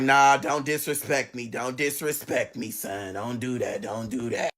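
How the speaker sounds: low-pitched mock warning; imitated low, muttered rebuke; warning mother. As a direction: drop low and repeat it as a warning. Mother warns son, acted low.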